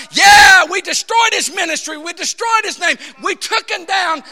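loud, high, triumphant crowing; hammed-up victory yelling; gloating shout. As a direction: cheer like demons celebrating a knockout. Hell's minions gloating, acted.

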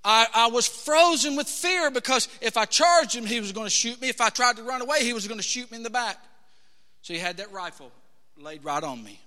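ordinary delivery

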